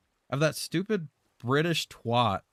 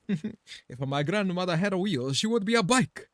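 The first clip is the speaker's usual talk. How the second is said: Italian accent impression; 6 semitones higher than usual. Streamer quoting the Italian Geo guy in a mock Italian accent. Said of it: "the Italian guy".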